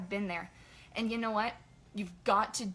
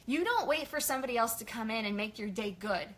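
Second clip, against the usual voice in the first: ordinary talk, nothing marked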